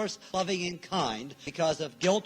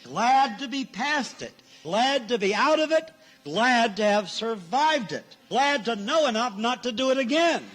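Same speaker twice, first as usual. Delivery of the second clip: higher, insistent relief, punching each "glad"; emphatic gladness; 3 semitones higher, 4 dB louder than usual. Acted. Preacher telling listeners they're glad it's over, emphatic. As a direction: build a rousing list of relief.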